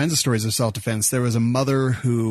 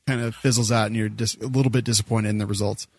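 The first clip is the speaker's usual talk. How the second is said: ordinary talk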